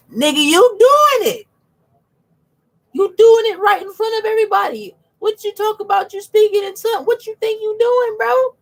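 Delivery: high, loud, disbelieving callout; incredulous mock callout. Mocking tongue speakers to his viewers, high incredulous callout. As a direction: call them out in a high, outraged squeal.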